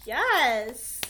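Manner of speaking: loud, triumphant yes; a loud inner cheer. Retold or imitated. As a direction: cheer her on. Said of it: "And I'm like".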